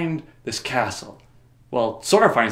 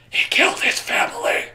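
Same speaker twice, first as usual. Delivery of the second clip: mock dramatic outburst. Voicing Cloud's backstory.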